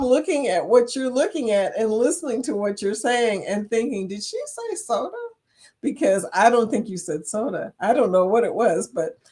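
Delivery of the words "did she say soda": high, softer mock-baffled question; puzzled. Viewer retelling her thought at a vlog, puzzled. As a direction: wonder aloud, as if mishearing.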